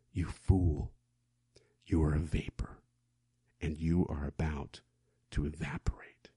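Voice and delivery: low, hushed whisper of warning; whispered rebuke. Acted. Preacher voicing James as a hushed, ominous rebuke.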